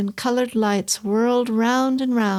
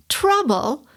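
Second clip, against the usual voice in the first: spat back; 7 semitones higher than usual. Acted. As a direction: spit the word back at him. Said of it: "the big woman spat back".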